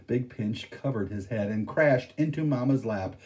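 plain reading voice

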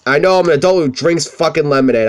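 ordinary talk

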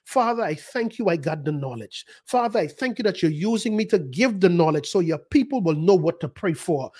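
normal talking voice

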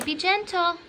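normal talking voice

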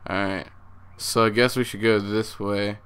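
ordinary delivery

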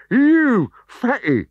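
high, loud slow snarl. Acted. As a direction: sneer it at him. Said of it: "he snarled at Obelix".

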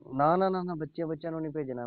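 high, loud rushed no, no, no; hasty protest. Real, not acted. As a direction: stop her idea at once, alarmed. Babaji refusing sending a child. (ਉੱਚੀ ਤੇ ਕਾਹਲੀ ਨਾਂਹ)

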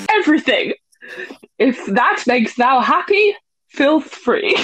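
lofty mock-Bard delivery; theatrical Shakespearean. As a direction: deliver it slowly, like a hammy stage actor. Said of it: "I'll do it Shakespearean".

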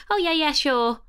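quick, high, breezy brush-off; flippant. Retold or imitated. Brushing off a big question in a meeting, flippant. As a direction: toss off a yes without thinking. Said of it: "almost in quite a sort of flippant way".

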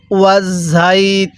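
learner model of ওয়াজাইতুনি with long, held vowels; drawn-out recitation. Shown as a demonstration. Teacher shows learners how to read, drawn-out recitation of ওয়াজাইতুনি. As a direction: stretch the vowels of ওয়াজাইতুনি slowly and evenly. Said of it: "তাহলে কিভাবে পড়ব".

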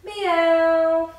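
loud cat meow. Shown as a demonstration. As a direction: belt out a playful cat meow. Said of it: "make the cat noise".

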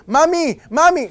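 put-on high loud child calling mami; a little kid wheedling his mom; pleading kid. Child begging mother for shoes, high pleading call.

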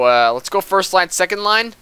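ordinary talk

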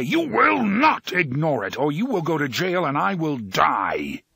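loud commanding roar. A narrator's reading. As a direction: roar the threat. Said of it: "the voice roared".

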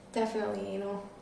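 ordinary talk

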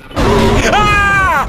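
startled scream of fright; scared scream. Real, not acted. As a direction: shriek at the jump scare. Jump scare in horror game.

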